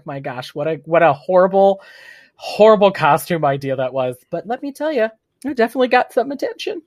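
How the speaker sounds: ordinary talk, nothing marked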